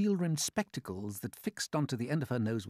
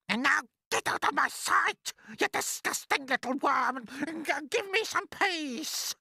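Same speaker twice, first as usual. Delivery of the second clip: high, loud dismissal, slowing at the end; cruel, furious aunt; 5 dB louder than usual. Acted. Aunt ordering the boy out of her sight, furious. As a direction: drive the child off with high-pitched fury.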